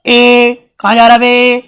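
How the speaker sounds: ए ए shouted like calling someone; a loud mock call to a boy walking off. Shown as a demonstration. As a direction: call across the street. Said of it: "जब आप किसी को बुलाएंगे ना".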